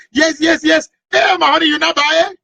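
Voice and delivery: high excited phone yell; wife squealing with delight on the phone. Retold or imitated. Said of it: "Oh, yelling on the phone".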